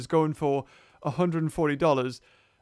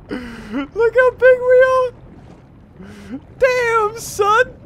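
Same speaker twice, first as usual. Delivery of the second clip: amazed shout; 9 dB louder, much slower than usual. Real, not acted. Amazed at big shark.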